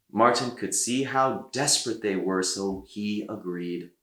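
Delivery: ordinary delivery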